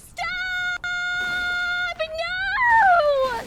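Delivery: long, very high scream of protest; overwhelmed shriek. Real, not acted. Streamer overwhelmed by a viewer's surprise, shrieking. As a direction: shriek it and hold each word out.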